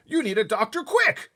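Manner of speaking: very high, loud, slow frantic king; crying King. Acted. King of Sweden crying to the Queen, high alarm. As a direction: cry out like the King. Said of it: "cried the King".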